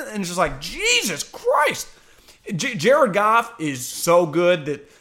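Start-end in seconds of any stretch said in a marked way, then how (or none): 0.6-1.9 very high, drawn-out disbelief; high exasperated outburst; acted exasperation. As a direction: stretch it out in mock dismay. Acts exasperated reaction to the play.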